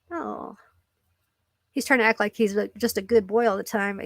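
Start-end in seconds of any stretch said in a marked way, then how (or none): none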